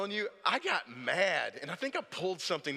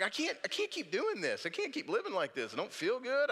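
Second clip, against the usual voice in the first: exasperated complaint; 9 semitones higher than usual. Retold or imitated. Retold frustrated complaint to wife.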